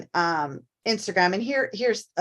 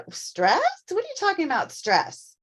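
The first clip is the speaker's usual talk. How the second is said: high, disbelieving question, dropping at the end; playacted disbelief; mock incredulous; 9 semitones higher than usual. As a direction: scoff at the very idea. Mimics someone denying any stress.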